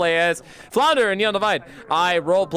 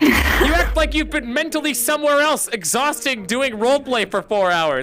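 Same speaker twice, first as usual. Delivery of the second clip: high exasperated ranting, laughing at the end; hammed-up fury at a bungled line; mock outrage; 9 semitones higher, 4 dB louder than usual. Real, not acted. Streamer hamming up outrage at a friend who flubbed an insult, mock fury.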